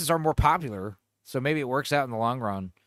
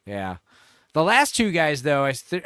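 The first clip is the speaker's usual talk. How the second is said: usual speaking voice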